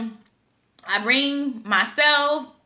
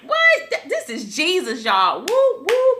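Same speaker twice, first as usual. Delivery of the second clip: high squealing "What?" then a joyous whoop; high, excited cry of joy; excited disbelief; 7 semitones higher, slower than usual. Retold or imitated. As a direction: burst out in thrilled disbelief. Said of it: "So I'm excited".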